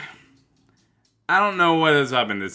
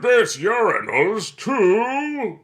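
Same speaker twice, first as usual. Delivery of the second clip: mock voice; 6 dB louder, much slower than usual. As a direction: drawl it out in a silly put-on voice. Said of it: "Is like".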